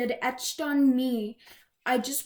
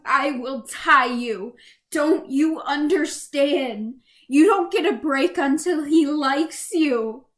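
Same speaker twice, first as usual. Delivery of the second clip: louder, relentless barrage of orders; furious commands; 5 dB louder than usual. Acted. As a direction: drive each threat home, rising in force. Abuser's commands voiced in a poem.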